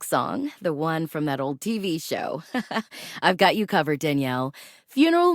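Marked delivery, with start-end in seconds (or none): 2.5-3.2 brief, breath-only laugh; soft little laugh between phrases; amused chuckle. Acted. Radio host recalling that old TV show, soft chuckle.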